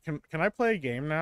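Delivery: put-on bored kid. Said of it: "a kid that's bored and they're like".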